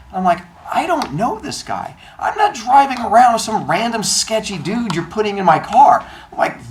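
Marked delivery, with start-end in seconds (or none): 0.7-6.0 high, outraged disbelief; incredulous refusal. Retold or imitated. Refusing to drive a sketchy stranger, high and incredulous. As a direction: shoot up high, appalled at the idea.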